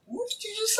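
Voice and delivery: very high, soft imitation of mom; high-pitched retold question; imitated shocked mom. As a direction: mimic a mother's disbelief in a high, hushed voice. Quotes mother's shocked question.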